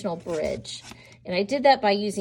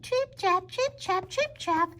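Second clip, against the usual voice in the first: hoof clatter; 10 semitones higher, 5 dB quieter than usual. Shown as a demonstration. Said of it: "hoof sounds of the little goat".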